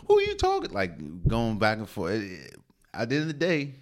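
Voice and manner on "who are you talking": mock coach outrage. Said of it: "practice stop".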